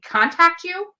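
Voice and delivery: ordinary delivery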